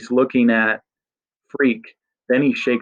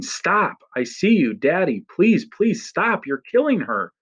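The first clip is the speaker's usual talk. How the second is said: put-on a terrified boy crying out to his father to stop; desperate pleading cry; 8 semitones higher than usual. Child begging father to stop.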